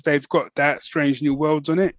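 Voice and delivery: usual speaking voice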